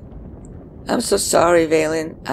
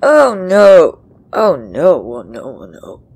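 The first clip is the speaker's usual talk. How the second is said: starts loud, then fades to a murmur; loud dismay trailing off; 3 semitones lower, 7 dB louder than usual. Real, not acted. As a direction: panic at first, then sink into resignation. Reacting in dismay to the game.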